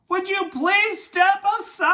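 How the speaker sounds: high, loud call to the moose. A narrator's reading. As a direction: shout a plea across the slope. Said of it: "so he called to the moose".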